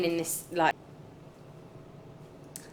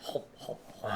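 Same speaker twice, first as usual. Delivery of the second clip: whispered; 10 semitones lower, 15 dB quieter than usual. Pretend. Playing Pac-Man while hopping.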